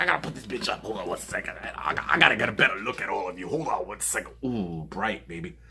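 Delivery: soft low mumbling aside. Real, not acted. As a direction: mumble while fiddling with the camera. Mumbling while fixing camera.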